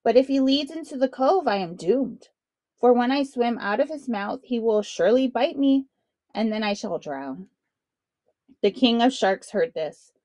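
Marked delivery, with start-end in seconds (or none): none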